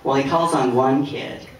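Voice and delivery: ordinary talk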